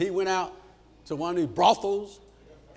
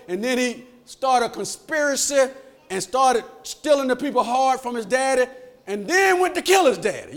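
high, driving pulpit cadence; preaching at full force; 7 semitones higher, 8 dB louder than usual. Real, not acted. Preacher telling Absalom's story to the church, preaching forcefully. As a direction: punch out the story in loud, raised bursts.

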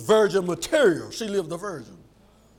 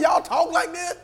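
high, incredulous retold question; shocked teacher; 10 semitones higher than usual. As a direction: act out the teacher's disbelief. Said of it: "I was shocked".

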